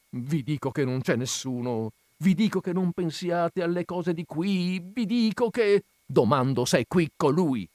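two voices talking over each other, escalating; mounting insistence. A narrator's reading. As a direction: pitch and volume climbing on each "vi dico".

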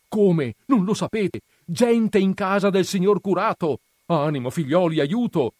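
loud, high cry for help; urgent loud call for help. Acted. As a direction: rouse the whole village. Sacristan alerts the village.

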